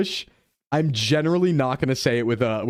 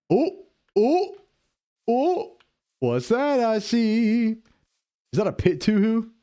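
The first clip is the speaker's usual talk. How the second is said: high excited gasp; thrilled at the find; 8 semitones higher, slower than usual. Real, not acted. Excited discovery while gaming.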